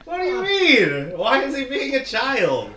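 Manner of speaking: high, disbelieving what; laughing mock outrage at the claim; incredulous. Real, not acted. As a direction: pitch shoots up in disbelief. Incredulous pushback in argument.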